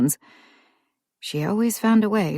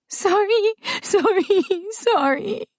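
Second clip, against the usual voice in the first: put-on high, sobbing child's sorry; child crying repeated apologies; slower than usual. A narrator's reading. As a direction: blubber it out through sobs like a little girl. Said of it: "she burst into sobbing, kept saying".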